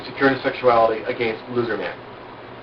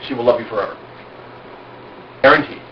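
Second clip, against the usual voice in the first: ordinary delivery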